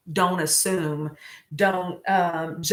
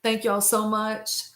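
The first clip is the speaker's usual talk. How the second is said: normal talking voice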